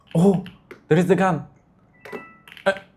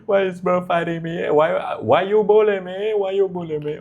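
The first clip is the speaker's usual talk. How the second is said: mock whining; 8 semitones higher, 3 dB louder than usual. Whining while being attacked.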